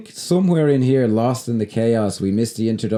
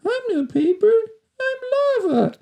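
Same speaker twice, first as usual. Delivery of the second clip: extremely high, squeaky fake child; mock kid's voice. Showing how the dubbed boy declares himself lava, mock kid's voice. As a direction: imitate a grown-up badly dubbing a small boy. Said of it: "an adult doing a kid's voice".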